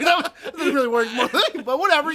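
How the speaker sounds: high, loud, helpless laughter in the words; real giggling fit over a failed joke; laughing hard. Real, not acted. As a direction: keep talking while cracking up. Joke falls apart in laughter.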